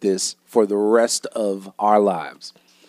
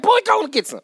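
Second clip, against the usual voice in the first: much higher impatient mom voice; imitating his mother. Son retells mom urging him again, impatient high mom voice. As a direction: shoo the boy off like mom. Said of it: "Why you sound just like her?".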